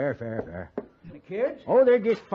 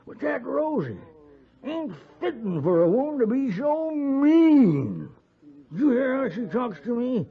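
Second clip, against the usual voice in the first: higher, worked-up grumble; indignant complaint; 7 semitones higher than usual. Acted. Drunk husband griping about his mean wife Rosie. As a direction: rile yourself up about her.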